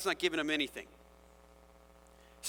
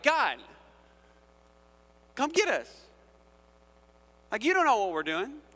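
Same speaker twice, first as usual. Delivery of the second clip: higher, louder mocking dare; taunting God; 6 semitones higher, 5 dB louder than usual. The arrogant daring God to act, mocking and loud. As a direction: sneer a challenge upward. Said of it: "They're like".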